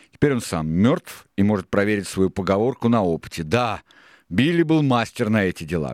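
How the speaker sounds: emphatic, high-pitched character talk; Israel reminiscing. A narrator's reading. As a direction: recall an old shipmate with relish and force. Reader voices Israel reminiscing.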